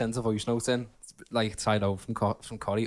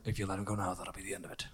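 Liam Neeson impression; 4 semitones lower, 11 dB quieter, faster than usual. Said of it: "That's Liam Neeson".

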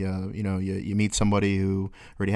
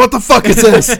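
confused groggy yell; 12 semitones higher, 11 dB louder than usual. Retold or imitated. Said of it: "He's like".

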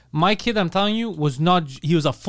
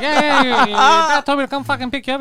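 high, louder kid impression; raised-pitch mock brush-off; swearing kid; 9 semitones higher, 5 dB louder than usual. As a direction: imitate an impatient cursing boy. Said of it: "he was swearing at me too".

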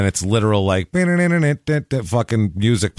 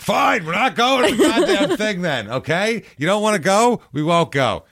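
mock petulant boyfriend; 12 semitones higher than usual. Boyfriend mocking a nosy girlfriend, hammed-up high sulk. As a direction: play the wounded planner throwing a fit. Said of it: "This is so mean".

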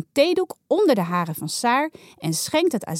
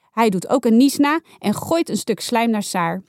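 plain reading voice

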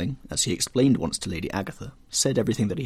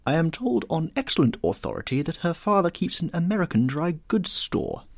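supercilious; 8 semitones higher than usual. A narrator's reading. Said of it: "looking supercilious".

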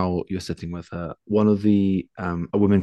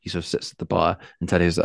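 ordinary talk

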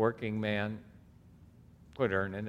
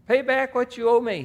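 much higher and loud demand; angry demand; 10 dB louder than usual. Acted. Servant demanding money from his fellow servant, high and loud. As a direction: bark it at the debtor.

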